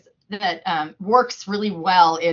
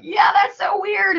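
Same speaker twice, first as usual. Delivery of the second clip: high, loud, drawn-out mock squeal; imitated kids' chorus; 12 semitones higher, 7 dB louder, slower than usual. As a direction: mimic a roomful of kids crying out together. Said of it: "they're all like".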